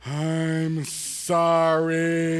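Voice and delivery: slow, drawn-out apology with a long pause before sorry; sincere sorry. Retold or imitated. Boy told to mean it, now a drawn-out earnest sorry. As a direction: lean into it like you truly mean it. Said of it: "say it like you mean it, like you're actually sorry".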